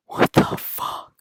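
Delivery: breathless whispered what the fuck; stunned whisper; shocked. Retold or imitated. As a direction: gasp it out in disbelief.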